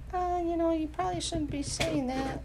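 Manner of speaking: soft and lifted, gently correcting; a kind nudge, barely above a breath; tender small voice. Retold or imitated. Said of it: "that little small voice ... so tender".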